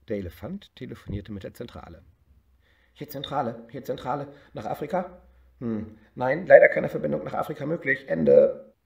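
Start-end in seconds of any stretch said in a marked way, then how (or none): 2.9-8.7 higher, louder, fast officious operator; switchboard operator voice. Acted. Operator tells elephant no line to Africa, fast and loud. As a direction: rattle off the bad news.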